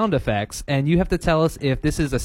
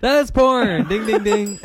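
triumphant higher-pitched verdict; game show host; 5 semitones higher than usual. Pretend. Host telling contestant he got it right, excited announcer. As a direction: hit it like a winning-answer buzzer.

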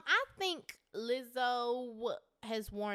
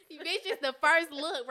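usual speaking voice

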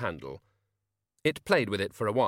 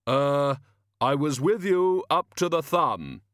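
very slow, booming, grave voice; Death's voice; 5 semitones higher, 6 dB louder, slower than usual. Acted. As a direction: drag each word out like a tomb.

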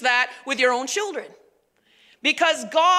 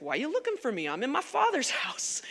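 very soft imitation of young Jesus; understated, hushed quoted answer; soft retold reply; 10 dB quieter, faster than usual. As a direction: answer quietly, as a small aside.

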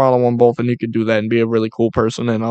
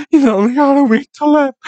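much higher, louder, overdone dramatic wail; mock tragic girl voice; 12 semitones higher, 5 dB louder than usual. Mocking the owner's post, melodramatic high voice. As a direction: ham up the sad news. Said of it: "She's like".

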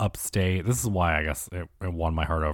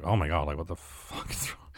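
startled inner whisper; 3 semitones lower, 9 dB quieter than usual. Retold or imitated. Rattled by a woman staring at him in the bar, he whispers under his breath. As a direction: drop to a breathless whisper, mostly unvoiced.

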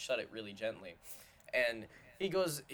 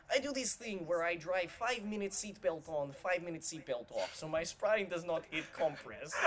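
put-on higher, a light Russian accent; Russian driver impression; 6 semitones higher than usual. Quoting the Russian Uber driver to friends, light Russian accent. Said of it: "And he was like".

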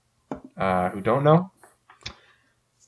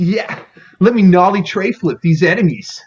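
skater dude voice; 7 semitones higher, 5 dB louder than usual. Pretend. Imitating the skater turtle.

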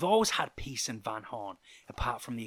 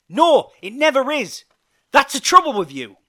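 loud, high-pitched accusation; angry retort; 13 semitones higher, 18 dB louder than usual. A narrator's reading. Joe rounding on Hank in their argument, angry and loud. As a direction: throw the blame back at full volume. Joe's shouted retort in quarrel.

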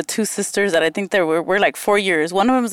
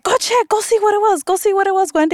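mock urging sisters; 11 semitones higher than usual. Sisters urging her to check.

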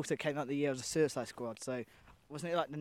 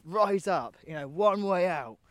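rallying speech quote; 3 semitones higher, 8 dB louder, slower than usual. Retold or imitated. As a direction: call it out like a prison-break speech. Re-enacting the rousing prison speech.

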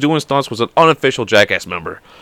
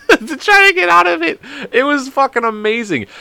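words pushed out high through a laugh; real, helpless laughter over the words. Real, not acted. Host laughing about faking sadness to escape trouble, laughing.

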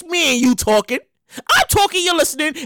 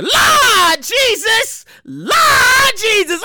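drawn-out Lord Jesus; 5 semitones higher, much slower than usual. Real, not acted. Host filing the story under his catchphrase. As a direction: call it out long. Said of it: "show catchphrase".

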